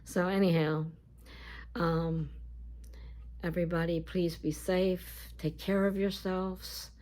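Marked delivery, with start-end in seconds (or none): none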